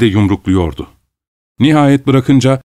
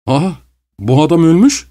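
mild exclamation; 6 semitones higher than usual. A narrator's reading. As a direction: call it out in understated shock. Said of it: "diye bağırdı". (hafif bir şaşkınlıkla bağırarak)

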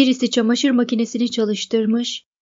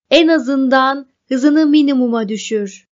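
raised, louder voice of alarm; narrated shout; 3 semitones higher, 6 dB louder than usual. A narrator's reading. Montag to Mildred in the speeding car, shouting with some restraint. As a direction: call out over engine noise. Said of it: "diye Montag haykırdı".

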